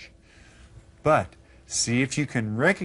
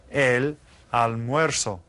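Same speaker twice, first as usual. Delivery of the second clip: El almuerzo said slowly and clearly; slow answer; slower than usual. Shown as a demonstration. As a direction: reveal the answer slowly for students to repeat. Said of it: "do you remember?".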